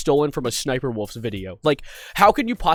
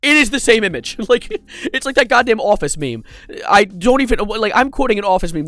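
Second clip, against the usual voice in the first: exasperated, higher and louder; lost for words, voice raised in disbelief; distraught; 6 semitones higher, 4 dB louder than usual. Real, not acted. Host reacting to copied thumbnails, distraught. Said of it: "That's how distraught I am".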